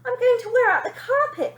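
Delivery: high, loud panicked cry. A narrator's reading. Confused mother panicking on the stairs, high and loud. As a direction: call out in a fluster. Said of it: "in something of a panic".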